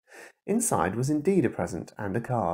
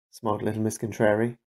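plain reading voice

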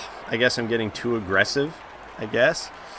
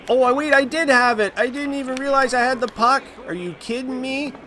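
surprised; 11 semitones higher than usual. Real, not acted. Surprised at having the puck.

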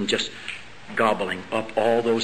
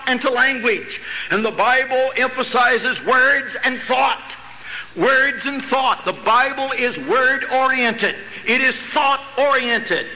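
raised, urgent pulpit voice, slowing to hammer points home; preaching at full force; 12 semitones higher than usual. Acted. Preacher pressing the congregation about change, full-force sermon. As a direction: preach it out to the whole hall.

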